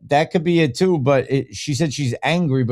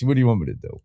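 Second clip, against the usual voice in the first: normal talking voice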